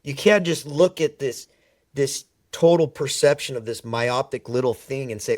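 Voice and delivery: ordinary delivery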